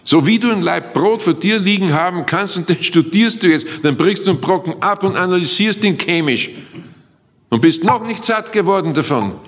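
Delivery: impassioned sermon delivery; fervent preaching. Acted. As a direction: preach it out with full conviction. Preaching with raised voice.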